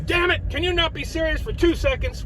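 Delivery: high-pitched exasperated mom voice, mocking her; imitating mom. Son mimicking his mom nagging him to be serious, high mock scold. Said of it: "my mom always used to say to me".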